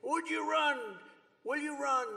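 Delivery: imitating reporters yelling questions; mock screaming reporters. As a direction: pester like a shouting reporter. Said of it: "these maniacs are screaming".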